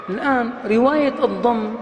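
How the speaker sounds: usual speaking voice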